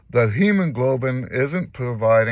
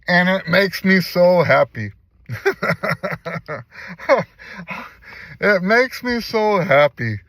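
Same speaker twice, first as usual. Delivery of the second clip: higher and louder, laughing through joy; emotional laughing delight; overjoyed; 6 semitones higher, 4 dB louder than usual. Real, not acted. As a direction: let the joy burst out with a laugh.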